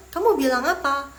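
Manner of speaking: puzzled exclamation. Acted. Teacher acts a learner who didn't catch it, puzzled exclamation. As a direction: play a listener who missed it.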